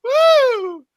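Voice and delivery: high-pitched cackle and whoop; gleeful shrieking laugh. Real, not acted. Gloating over a rival's downfall, high shrieking laugh and whoop. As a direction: laugh hard, way up high, then cheer.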